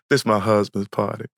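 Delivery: lightly voiced low Southern woman; a hint of a deep, unbothered diva; deep-voiced star. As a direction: drop low for a mild celebrity imitation. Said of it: "She got that deep voice".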